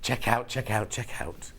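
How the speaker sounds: hushed whisper to spare a failing voice. Shown as a demonstration. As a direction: drop to a soft, low whisper. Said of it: "So I'll whisper".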